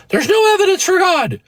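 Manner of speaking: very high-pitched mock whine; mocking atheist voice. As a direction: squeal it like a caricature of the other side. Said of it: "You know, they go".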